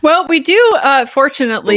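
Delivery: suddenly loud opening; booming into the mic. Real, not acted. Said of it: "you came in really loud".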